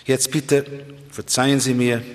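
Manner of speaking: normal talking voice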